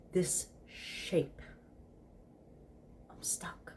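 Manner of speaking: a hushed, whispered confession; whispered snake voice. Acted. Crowley stuck as a snake, whispering to Aziraphale. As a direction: whisper it, embarrassed and trapped.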